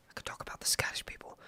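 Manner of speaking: fast, secret whisper. Pretend. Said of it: "whispers secretly".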